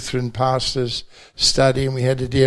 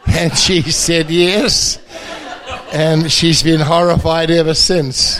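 laughing through the punchline, louder; giggling at his own joke; words bubbling with real laughter; 5 semitones higher, 5 dB louder than usual. Real, not acted. Punchline about his wife's answer.

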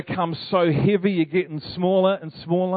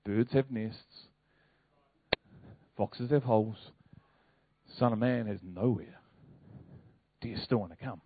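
soft quoting; 8 semitones lower, 12 dB quieter than usual. Retold or imitated. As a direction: hush it like a gentle challenge. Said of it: "Jesus said".

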